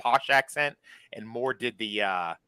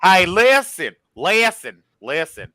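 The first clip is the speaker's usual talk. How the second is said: put-on Southern accent; 6 semitones higher, 6 dB louder than usual. Man joining in the Southern imitation, hammed-up Southern accent. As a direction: ham up a Southerner demanding to be heard. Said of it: "people in the South are more like".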